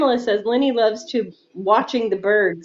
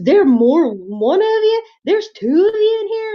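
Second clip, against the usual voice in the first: high, rising surprised questions; mock surprise; 8 semitones higher than usual. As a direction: act startled at finding a second raccoon.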